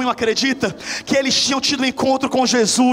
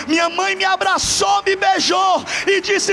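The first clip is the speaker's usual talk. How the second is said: emotional preaching shout; 6 semitones higher, slower than usual. Acted. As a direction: cry it out slowly from high up. Climax of mother's embrace.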